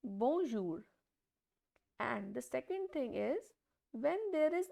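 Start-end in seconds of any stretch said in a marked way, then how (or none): 0.0-0.8 "bonjour" with the j between j and sh, falling; "bonjour" modelled for the class; French bonjour. Shown as a demonstration. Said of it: "we will pronounce it as".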